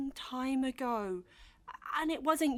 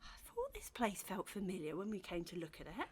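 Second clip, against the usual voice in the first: soft unvoiced whisper, much softer; whispered realisation; 5 semitones lower, 11 dB quieter than usual. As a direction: breathe it out as it dawns on you. Realising quietly.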